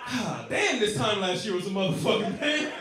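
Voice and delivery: normal talking voice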